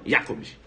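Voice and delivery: low "Jacobs", YA-cobs; joined-up pronunciation. Shown as a demonstration. Teacher checks students understood, German pronunciation. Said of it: "Agora junta".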